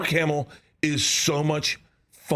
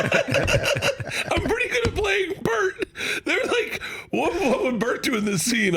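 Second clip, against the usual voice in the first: laughing high; 12 semitones higher than usual. Real, not acted. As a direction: giggle as you tell it. Laughing high while retelling.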